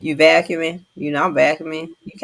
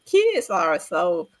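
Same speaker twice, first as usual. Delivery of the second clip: usual speaking voice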